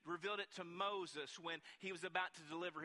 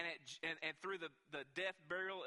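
ordinary talk

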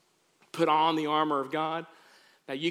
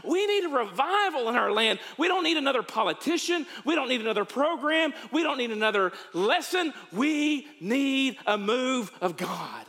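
raised, higher preaching cry; fervent prayer; 9 semitones higher than usual. Acted. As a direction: plead with God from the pulpit. Opening prayer at full force.

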